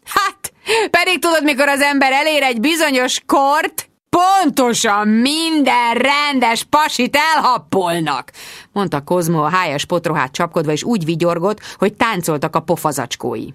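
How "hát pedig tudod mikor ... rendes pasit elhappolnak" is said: high-pitched butting-in call; slow, high mocking interjection. A narrator's reading. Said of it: "közbe kiáltott".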